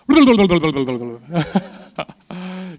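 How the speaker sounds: a loud burst of real laughter; embarrassed laugh. Real, not acted. To the audience after asking for extra minutes, a loud sheepish laugh. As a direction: laugh it off, caught running over time.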